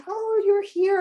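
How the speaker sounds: high bright surprise, pitched well up; delighted to spot her in the crowd; excited greeting. Retold or imitated. Author greeting the returning fan, high and excited.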